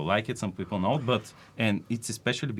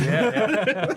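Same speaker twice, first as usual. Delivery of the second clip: laughing; 13 semitones higher, 6 dB louder than usual. Real, not acted. Laughing at the joke.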